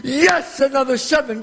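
high, jubilant yes; triumphant cheer. Acted. Gambler sees the kid roll another seven, triumphant cheer. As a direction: burst out at a winning throw.

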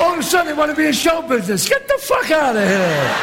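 high, fast, indignant rant; angry berating. Acted. Gambler throwing out a would-be performer, angry berating. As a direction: tear into him at speed.

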